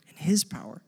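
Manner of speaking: whispered repeat of the last words; an awed whisper; hushed echo. Real, not acted. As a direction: breathe it out, barely voiced. Echoes own phrase, hushed.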